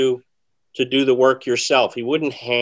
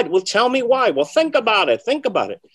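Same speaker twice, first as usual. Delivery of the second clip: higher and louder, teasing mentor pushing the student; mentor's "think about it" imitated high and loud; imitating Di Vernon; 11 semitones higher, 5 dB louder than usual. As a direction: make him work it out, pitched up.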